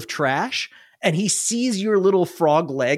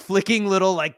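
ordinary talk, nothing marked

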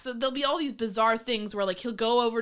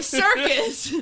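higher and louder, a showman's cry; mock grand exclamation; 10 semitones higher, 6 dB louder than usual. Real, not acted. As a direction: announce it like a cheesy ringmaster. Mock proclaiming with a laugh.

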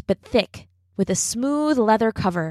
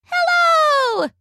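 high, sweet little fairy voice; tiny bright fairy greeting; pleasant little fairy voice; 4 dB louder than usual. A narrator's reading. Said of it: "said the fairy in a pleasant little voice".

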